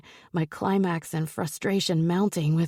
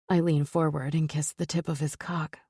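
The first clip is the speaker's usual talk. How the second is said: ordinary delivery, nothing marked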